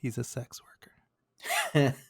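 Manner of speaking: slow whispered mock reveal; teasing whisper. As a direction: whisper it like a naughty secret. Teasing aside about unnamed job.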